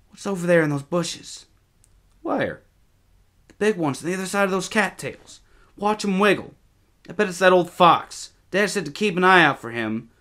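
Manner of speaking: put-on high boyish voice, held back; curious, watchful boy. A narrator's reading. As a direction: alert boy spotting something in the bushes. Boys spot movement in bushes.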